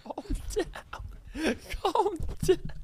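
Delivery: high, soft squeak through laughter; helpless high-pitched giggly urging; laughing plea. Real, not acted. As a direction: try to soothe while cracking up. Cohost breaking up while soothing him.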